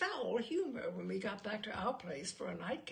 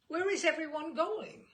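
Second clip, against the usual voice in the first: higher and louder, pushing to leave; impatient interruption; 6 semitones higher, 6 dB louder than usual. Acted. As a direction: cut him off and ask where everyone went. Bill interrupts the lecture.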